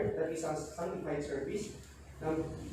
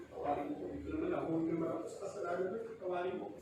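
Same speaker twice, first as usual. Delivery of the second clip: quiet prayer; 6 dB quieter, faster than usual. Acted. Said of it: "babagabagi ka na".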